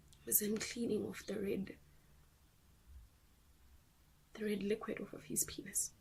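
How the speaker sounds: very soft, near-whispered disclosure; hushed horror. Acted. Parent reveals who was in the baby's room, hushed. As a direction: drop almost to a whisper, halting between phrases. Recounts discovering abuse of baby.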